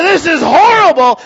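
mock despair. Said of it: "sitting there going, uh, in old despair".

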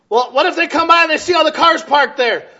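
loud, high preaching; preaching at full force. Acted. Pastor preaching hard to his church, loud and high. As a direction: raise the voice over the congregation.

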